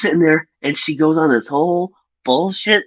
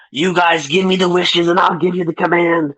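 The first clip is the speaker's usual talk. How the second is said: a lightly raised, hammed-up villain offer; villain's voodoo voice; 5 semitones higher than usual. Reviewer mimics the villain's bargain, lightly hammed-up. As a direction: toss it off like a movie villain striking a deal. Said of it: "you know, doing something like that".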